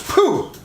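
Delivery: popping-open sound effect. Retold or imitated. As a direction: make a quick whooshing pop noise. Imitates spring-loaded thing popping open.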